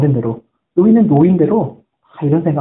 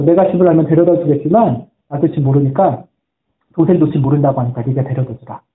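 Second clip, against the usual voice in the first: ordinary talk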